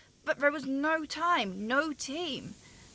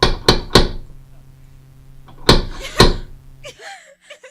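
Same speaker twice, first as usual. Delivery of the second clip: high, piercing laugh breaking into a cry; shrieking broken laughter; hysterical laugh-cry; 18 dB louder than usual. Acted. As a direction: lose it in a manic sob. Breakdown after guilt confession.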